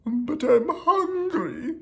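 high, drawn-out royal wail; hammed-up high, loud protest; yelling king. A narrator's reading. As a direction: yell it slowly like a whiny cartoon king. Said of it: "yelled the king".

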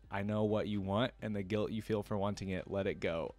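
ordinary delivery

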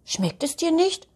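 high-pitched questioning elf voice; high, solicitous character question; concerned elf. Acted. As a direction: ask lightly, pitched well up. Elf asks dog about the soup.

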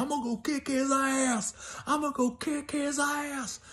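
put-on high, muttered drunk boyfriend vow; a hint of a drunk boyfriend swearing revenge; drunk threat. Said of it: "and he's going".

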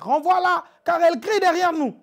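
loud, high, insistent disciples. Acted. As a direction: push Jesus to send her away. Disciples urging Jesus, acted.

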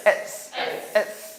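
"S" drilled as one-syllable eats; crisp eats for "S"; English ts ending. Shown as a demonstration. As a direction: hiss the ts ending of "S". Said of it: "S 발음".

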